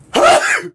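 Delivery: sharp explosive sneeze; loud sudden sneeze; violent sneeze. Real, not acted. Sneezing test with eyes open.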